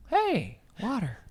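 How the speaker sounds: high, slow mock cheer; a playful high mock hooray. Real, not acted. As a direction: cheer the water in a high voice, hammed-up.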